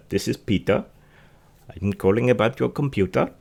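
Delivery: a light, put-on Indian call-centre accent; a mild Indian accent, cold-calling as Peter. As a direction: lightly imitate an Indian scam caller's accent. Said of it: "The, the accent is that thick".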